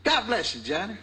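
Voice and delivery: high, sweetly blessing preacher; Reverend Watson. Retold or imitated. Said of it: "he looked at me, he goes".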